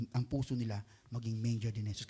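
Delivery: ordinary delivery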